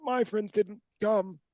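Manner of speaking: high, drawn-out, sheepish explanation; mock-pitiful high, halting excuse; awkward wedding guest. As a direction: squirm through it in a small high voice. Retelling awkward moment at wedding.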